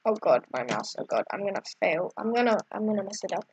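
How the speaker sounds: low, soft, rushed worry; nervous. Real, not acted. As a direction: fret under your breath. Nervous before a hard move.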